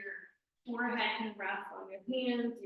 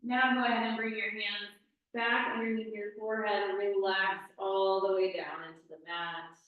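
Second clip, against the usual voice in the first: ordinary talk, nothing marked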